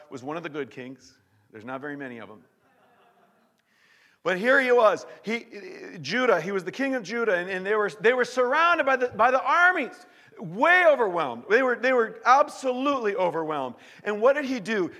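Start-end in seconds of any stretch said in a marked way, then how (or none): 4.2-14.9 loud, high storytelling; preaching at full force. Acted. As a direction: drive the tension up. Preacher retells battle at full force.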